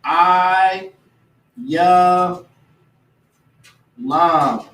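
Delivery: I, Ya, Lam said as separate slow syllables; spelled-out name. Shown as a demonstration. Teaching the name Elam, slow syllables. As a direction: break Ayalam into I - Ya - Lam with long pauses. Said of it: "in the Lashwan, you would say".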